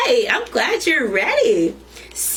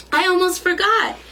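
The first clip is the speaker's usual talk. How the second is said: grandma voice; 8 semitones higher, faster than usual. A narrator's reading. Grandma's quoted line.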